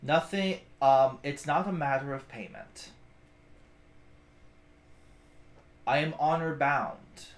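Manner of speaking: slow, low, solemn orc voice; a lightly voiced orc, grave and measured; orc's reply. Orc bodyguard to the party, solemn and slow. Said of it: "he goes".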